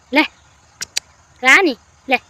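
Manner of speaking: loud call to the cat. Real, not acted. Girl offers Rani a treat, loud call. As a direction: call her over with a treat, loudly.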